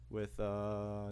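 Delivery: ordinary delivery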